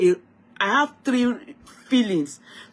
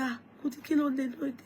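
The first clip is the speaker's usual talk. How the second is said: a husband's soft, far quieter worried question; worried husband, hushed; 5 semitones higher, 9 dB quieter, much faster than usual. Retold or imitated. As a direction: quietly ask your sick wife what's wrong.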